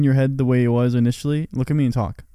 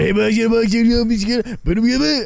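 high-pitched, garbled Cajun babble; Cajun accent; 9 semitones higher, 4 dB louder than usual. Acted. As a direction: jabber incomprehensibly like a caricature Cajun. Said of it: "you have a, a real Cajun accent".

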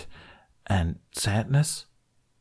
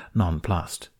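plain reading voice

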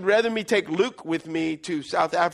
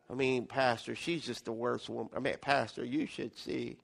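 mopey sulker droning low; mopey person; 9 semitones lower, 11 dB quieter than usual. Acted. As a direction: sink into a deep glum mumble. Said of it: "You ever see a mope person?".

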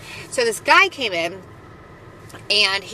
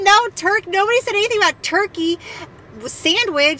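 exasperated correction; 10 semitones higher than usual. Retold or imitated. Coworkers correcting the beverage-cart girl, incredulous and high. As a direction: correct her in disbelief, pitched way up. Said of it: "We said".

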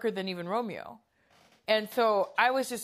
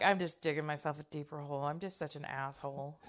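self-reproach, fading; 4 semitones lower, 8 dB quieter than usual. Retold or imitated. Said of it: "and I'm like".

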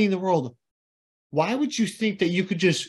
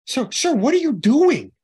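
higher and louder mock mom voice; imitating mom; 9 semitones higher, 6 dB louder than usual. Son imitates mom confronting the old man, high and loud. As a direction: call out like his worried mom, pitched way up. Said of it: "this is how she sounds, legitimately".

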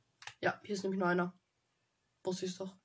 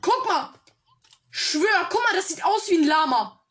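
excited; 6 semitones higher, 7 dB louder, slower than usual. Real, not acted. Excited about a llama shape.

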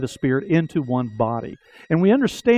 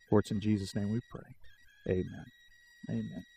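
prayer; 4 semitones lower, 3 dB quieter than usual. Acted. Leads congregation in prayer.

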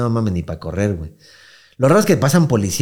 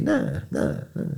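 high whiny nada; 13 semitones higher, 10 dB quieter than usual. Retold or imitated. Mimicking his reluctant younger self.

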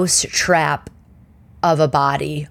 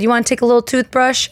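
higher, quick, sing-song offer; sweet hygienist; 7 semitones higher, faster than usual. Retold or imitated. Hygienist offering a free toothbrush, higher sing-song. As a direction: offer it like to a child.

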